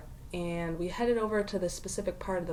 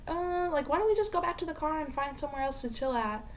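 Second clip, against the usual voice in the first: higher-pitched, quick hesitant suggestion; tentative suggestion; 8 semitones higher, 4 dB quieter, faster than usual. Retold or imitated. Suggesting they go back to the car, tentative. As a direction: float it casually, pitched up and hurried. Acting own nervous suggestion to leave.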